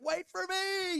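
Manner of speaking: high, loud yell after the bike; desperate shout. Retold or imitated. Crashed rider yelling after his bike as it rolls away, high desperate shout. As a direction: call out as if chasing a runaway bike. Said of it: "and I'm like".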